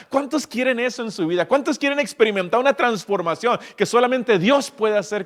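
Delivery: high, loud impassioned sermon; rising evangelical fervor; fervent preaching. Acted. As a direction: preach it with fire to the congregation. Preaching at full force.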